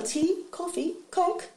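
chirpy trolley-service lilt; stewardess voice. Retold or imitated.

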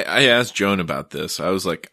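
ordinary talk, nothing marked

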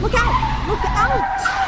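high, loud panicked scream; screamed warning. Acted. Annie screaming a warning as the cars collide, panicked. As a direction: scream it as the crash comes. Car about to crash.

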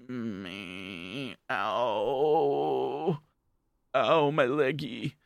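hammed-up childish hurt; mock crying. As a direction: fake little whimper, slow and pitiful. Siblings kick Hana's legs.

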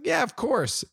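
eager yes. Retold or imitated. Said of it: "I was like".

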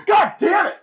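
very high, loud exasperated cry; hammed-up shout while storming off; acted angry shout. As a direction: imitate a furious parting yell. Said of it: "she walks away just going".

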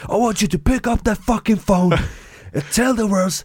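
a sales boss firing up his team; DiCaprio sales pep talk. Retold or imitated. Imitating a Wolf of Wall Street boss pumping up salespeople. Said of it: "Du vet, så här".

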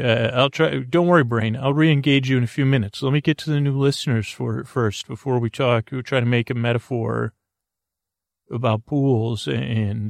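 usual speaking voice

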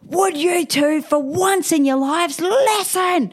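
high, strained, exasperated outburst; exasperated mum. Retold or imitated. Mum re-enacts snapping at two kids, exasperated. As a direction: drag it out, pitch climbing on the last word.

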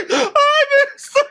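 high laughing squeak; can barely get it out for laughing; breaking up with laughter mid-phrase; laughing through words. Real, not acted. Laughing fit mid-sentence.

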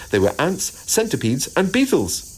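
ordinary delivery, nothing marked

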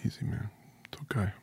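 very soft, near-whispered reassurance; quiet, tender murmur of support; hushed comforting. Real, not acted. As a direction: gently soothe under your breath. Soothing the choked-up interviewer.

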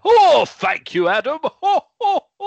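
beam it out as Santa; hammed-up Santa thanking Adam; jolly Santa. Switches back to Santa.

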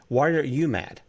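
usual speaking voice

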